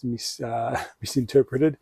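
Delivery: ordinary delivery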